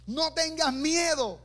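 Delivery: alto y lento, un grito contenido; mild mock shout. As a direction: Eliseo calmando a su siervo, medio gritando. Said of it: "le grita también".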